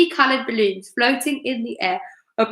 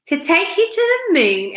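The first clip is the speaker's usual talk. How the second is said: mock exclaim; 7 semitones higher than usual. A narrator's reading. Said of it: "exclaimed Mister Twit".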